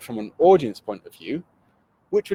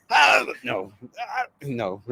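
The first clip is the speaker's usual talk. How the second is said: dismayed; much slower than usual. Retold or imitated. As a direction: yelp at the botched take. Flubbing a video intro.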